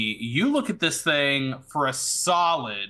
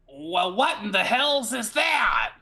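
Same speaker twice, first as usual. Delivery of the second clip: startled mechanic; 6 semitones higher than usual. Acted. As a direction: exclaim it in mock alarm at a strange find. Game master plays startled mechanic.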